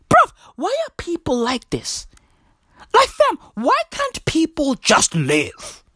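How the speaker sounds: exasperated rant. Retold or imitated. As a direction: plead with the world in mock despair. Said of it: "I was like".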